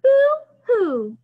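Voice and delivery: playful fake cry; mock crying. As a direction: ham up a baby boo-hoo. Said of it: "if we say boo-hoo".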